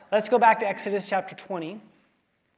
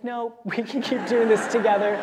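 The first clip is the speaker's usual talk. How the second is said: laughing daughter; 3 semitones higher, 3 dB louder than usual. Retold or imitated. Daughter declines skiing alone.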